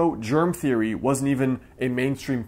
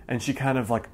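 normal talking voice

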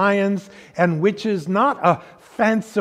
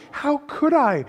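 Lucy pleading; 10 semitones higher than usual. A narrator's reading. First take of repeated plea.

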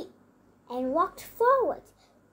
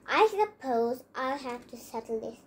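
lower-pitched, mildly sniffy line; 6 semitones lower than usual. A narrator's reading. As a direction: say it with a slight sniff of superiority.

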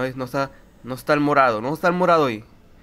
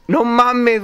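shocked; 8 semitones higher, 6 dB louder than usual. Real, not acted. Reaction to the scare.

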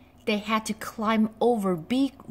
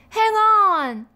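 high, loud rescuing call; loud call to hold on; shout; 11 semitones higher, 6 dB louder than usual. A narrator's reading. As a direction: shout across the gully. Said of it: "he shouted".

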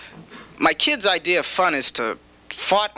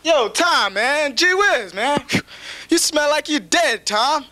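higher, brash streetwise friend; buddy ribbing a dying patient, higher and brash; blunt street buddy; 7 semitones higher than usual. Retold or imitated. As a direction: tease the patient like a rude old pal. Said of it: "You never hear".

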